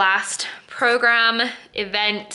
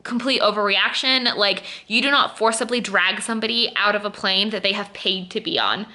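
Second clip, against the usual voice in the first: ordinary talk, nothing marked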